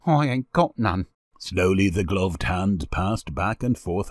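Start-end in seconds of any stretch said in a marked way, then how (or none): 0.1-1.1 put-on sullen boy, pitched up a little; grudging child's admission. A narrator's reading. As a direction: say it sulkily, like a street child. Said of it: "and then added sullenly".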